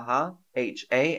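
normal talking voice

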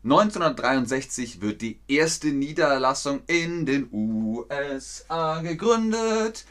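normal talking voice

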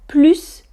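"plus" said "plys", rising. Shown as a demonstration. Teacher runs through the three forms. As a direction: end "plus" on an S with a rise. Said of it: "Les trois prononciations".

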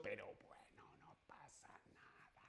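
whispered, to herself; a quick whispered shrug; whispered aside. Real, not acted. Teacher whispering an aside after repeating herself. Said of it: "Ya lo he dicho".

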